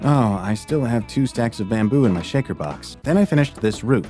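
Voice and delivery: normal talking voice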